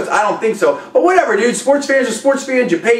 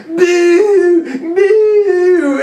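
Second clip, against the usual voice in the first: higher, mock booing like a jeering crowd; imitating booing crowd; 7 semitones higher, 4 dB louder than usual. Host mimics Montreal fans booing the fighter, mock booing. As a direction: boo it out like a hostile arena crowd. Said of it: "And you".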